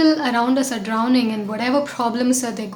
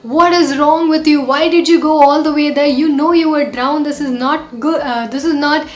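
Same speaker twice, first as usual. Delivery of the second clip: put-on higher, louder scolding shout at a drowning boy; passer-by shouting blame at the boy in the river, loud and angry; scolding yell; 3 semitones higher, 6 dB louder than usual. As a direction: tell him off hard, raised voice. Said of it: "that man yelled out to him saying".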